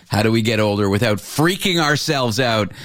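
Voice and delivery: ordinary delivery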